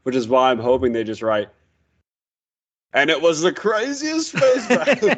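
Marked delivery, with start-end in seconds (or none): 2.9-5.2 much higher, rising hype; mock epic. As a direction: squeal the epic promise. Mocking the line again.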